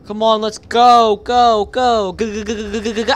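shouting go go go; loud urging. Real, not acted. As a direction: hurry them along, loud. Urging character on.

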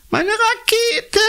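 put-on very high, slow child's wail; wailing little boy. A narrator's reading. Jakob sieht seine kaputte Rakete, hohes langgezogenes Kinderjammern. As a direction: cry out over the broken toy. Jakob sees broken rocket.

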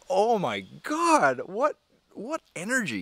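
high, loud, drawn-out astonishment; slow, emphatic exclamation of wonder; amazed awe. Retold or imitated. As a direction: gush as if bowled over. Acting own awed reaction to the story.

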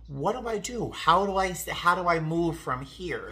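high, worried questions from a scared passenger; panicked pleading. Retold or imitated. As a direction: play the frightened pregnant passenger asking for help. Panicked passenger voiced in story.

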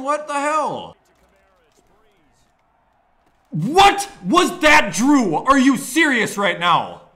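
high, loud furious yell; angry shouting. Real, not acted. Gamer furious at his quarterback, shouting. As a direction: rage at the screen, drawn out. Raging at the football game.

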